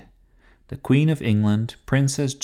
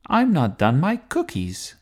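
high-pitched storybook protest; mild protesting yell; 8 semitones higher, 4 dB louder than usual. A narrator's reading. Archbishop dragged from his cookies, mildly yelling. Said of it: "yelled the Archbishop".

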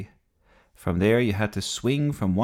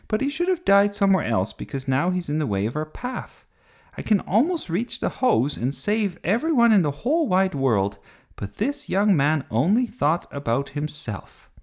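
put-on higher-pitched, animated child's complaint; bossy girl character, indignant; 9 semitones higher, 3 dB louder than usual. A narrator's reading. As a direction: grumble grandly as the thwarted heroine.

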